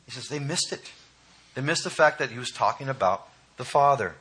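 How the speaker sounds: normal talking voice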